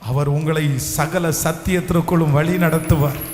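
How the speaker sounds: loud, high, impassioned sermon delivery, often slowed and shouted; full-force preaching. Acted. Preacher proclaiming God's promise to the congregation, loud and impassioned.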